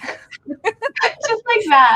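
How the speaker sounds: slow, high, laughing through the words; real sheepish laugh; embarrassed laugh. Real, not acted. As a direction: stretch it out while giggling. Admitting cute nicknames, laughing.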